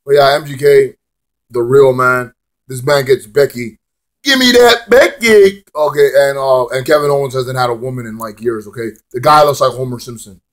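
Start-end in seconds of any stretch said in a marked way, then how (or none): 4.2-5.6 high, stretched-out silly holler; put-on greedy demand. Cheering the man who gets Becky, goofy high drawl. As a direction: draw out each word in a goofy voice.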